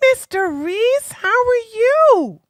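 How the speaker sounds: high, slow sing-song hello to a chatter; sing-song greeting. Real, not acted. Streamer greets a regular with a high, drawn-out hello. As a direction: lift it way up and stretch the greeting.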